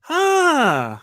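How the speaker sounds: drawn-out, high-pitched mock reaction; awkward high reaction. Family reacting to the chef's news, awkwardly high. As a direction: stretch it out high, playing a stunned listener.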